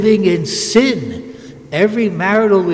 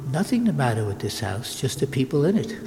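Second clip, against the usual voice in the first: much lower and softer, a gentle calm reply; calm mother; 4 semitones lower, 7 dB quieter than usual. Retold or imitated. Mother calmly answering her complaining son, soft and low. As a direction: settle it quietly like a patient mother. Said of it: "But she said calmly".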